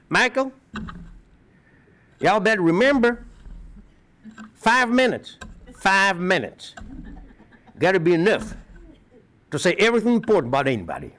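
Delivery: put-on high, drawn-out West Virginia accent over a Russian one; southern drawl impression. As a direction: imitate an old Southern professor. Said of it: "southern dialect of the American English with a Russian accent".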